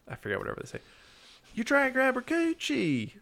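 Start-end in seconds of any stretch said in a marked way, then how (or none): 1.5-3.1 high, squeaky put-on voice of a teen girl in the car; mock girl voice. Quoting the girls in the car scene, high mock squeal. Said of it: "where they're like".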